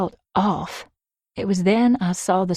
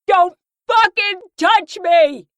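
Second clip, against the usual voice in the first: high, slow, dragged-out scream; 13 semitones higher, 8 dB louder, slower than usual. Acted. Mia screaming at the men holding her. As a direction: scream it at them. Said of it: "yelling bloody murder".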